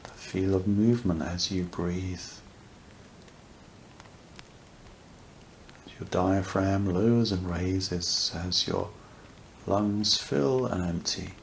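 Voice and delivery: unhurried meditation voice with long pauses; guided meditation. Real, not acted. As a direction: guide listeners gently into awareness, leaving space between phrases.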